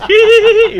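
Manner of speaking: very high squeaky hee hee hee; Sonny's giggle. Retold or imitated. Storyteller mimicking his friend's giggle, high squeaky hee hee. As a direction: imitate a tiny high-pitched giggle. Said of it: "that's how he laughs".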